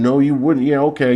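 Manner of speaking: usual speaking voice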